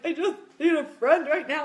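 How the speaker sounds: hammed-up weepy whine; mock crying. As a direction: imitate a tearful plea to a friend. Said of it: "in the closet crying".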